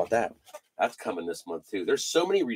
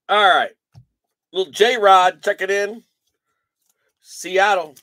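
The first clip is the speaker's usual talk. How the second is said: excited callout; 5 semitones higher, 8 dB louder than usual. Real, not acted. Announcing a pulled card.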